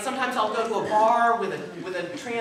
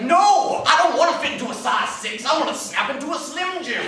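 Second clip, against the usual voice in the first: loud high mock tantrum; hammed-up defiant body voice; body's defiant shout; 7 semitones higher, 9 dB louder than usual. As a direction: shout it back stubbornly. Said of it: "And my body's like".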